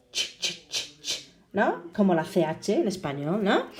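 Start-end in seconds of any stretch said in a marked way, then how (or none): 0.1-1.3 "ch" as short voiceless bursts; bare, soft "ch" consonant demo; isolated CH sound. Shown as a demonstration. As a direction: repeat "ch" evenly with no vowel, softly. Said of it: "Can you hear?".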